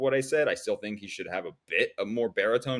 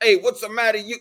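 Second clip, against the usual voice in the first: a quick Brooklyn tough-guy "Hey, what's the matter", higher and louder; Brooklyn accent; 12 semitones higher, 7 dB louder, faster than usual. Retold or imitated. Imitating how the Thing talks in the comics, Brooklyn accent. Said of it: "he has a deep Brooklyn accent".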